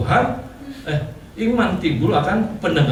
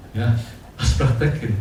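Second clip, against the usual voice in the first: ordinary talk, nothing marked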